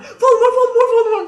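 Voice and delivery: high laughing shrieks of the name; screaming his name again. Retold or imitated. Teen running back to the bedroom, screaming with laughter. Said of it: "get out of the bathroom".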